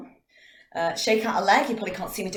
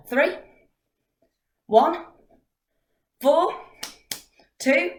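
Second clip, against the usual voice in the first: game caller; 8 semitones higher, 5 dB louder, much slower than usual. Shown as a demonstration. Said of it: "calling numbers for TikTok game".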